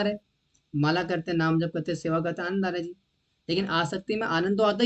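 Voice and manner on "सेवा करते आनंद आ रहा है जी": normal talking voice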